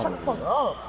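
ordinary delivery

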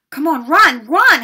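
high, loud, frantic shout; urgent yell. A narrator's reading. Boy urging his frozen friend to flee, yelling. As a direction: yell it with rising force. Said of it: "Harry yelled at Hermione".

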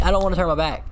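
ordinary talk

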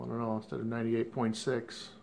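ordinary delivery

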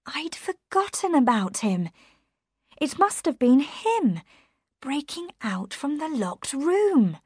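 high-voiced, urgent realisation; dawning realisation. A narrator's reading. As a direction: work it out aloud, pitched high, slowing at the end. Mary seizes on a suspect.

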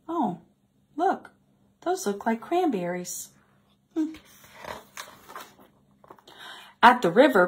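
put-on bright, animated read-aloud voice for kids; storybook reading. A narrator's reading. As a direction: read the tale with lively expression to children. Reading a picture book aloud.

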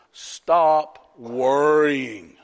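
very slow, drawn-out command; emphatic command. Real, not acted. Preacher announces point four to congregation, drawn-out command. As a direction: stretch each word for emphasis.

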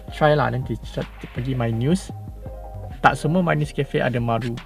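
ordinary delivery

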